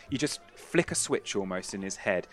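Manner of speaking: ordinary talk, nothing marked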